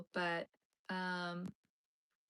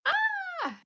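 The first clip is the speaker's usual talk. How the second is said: short high-pitched yelp; playful padded-cell shriek; mock scream; 7 dB louder than usual. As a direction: let out a sudden loud, high "Ah!".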